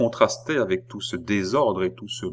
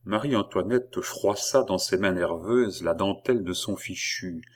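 ordinary delivery, nothing marked

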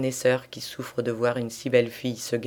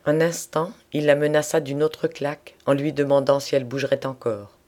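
usual speaking voice

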